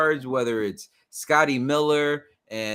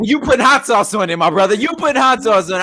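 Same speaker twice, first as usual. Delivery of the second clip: mock voice; 7 semitones higher, 11 dB louder, faster than usual. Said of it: "joking mock voice".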